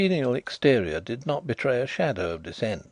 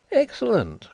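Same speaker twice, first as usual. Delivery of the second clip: high, louder exclamation of approval; delighted cry; 13 semitones higher, 5 dB louder than usual. A narrator's reading. Blind host hearing of his friend's agency, crying out. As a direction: cry out approval, pitched well up. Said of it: "cried Carrados".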